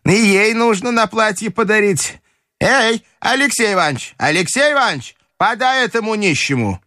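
high-pitched grandmother calling out orders; бабушка командует. Acted. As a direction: summon him in a high, imperious voice. (высокий повелительный голос бабушки)

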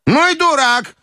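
very high, scornful exclamation; крик бабушки. Acted. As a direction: exclaim it high, at ordinary volume. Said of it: "крикнула бабушка". (высокий возмущённый возглас бабушки)

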